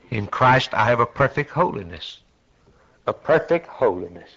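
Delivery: ordinary talk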